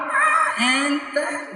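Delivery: slow, breezy drawn-out no-big-deal; drawn-out light dismissal. Acted. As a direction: stretch it out slowly, airy and easy. Preacher shows burden made light.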